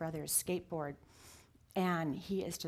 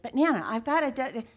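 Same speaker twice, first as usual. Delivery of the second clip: put-on higher, louder stammering child protest; a four-year-old trying to argue; lightly voiced boy cutting in; imitating a little boy; 4 semitones higher, 9 dB louder, faster than usual. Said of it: "But he said".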